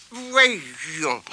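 put-on musing old woman, falling tune; old granny. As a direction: talk to yourself while cooking. Grandmother cooking, muttering recipe.

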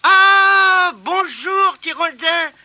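high, loud, glad greeting; cheerful granny. Acted. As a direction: greet him brightly. Grandmother greets the boy.

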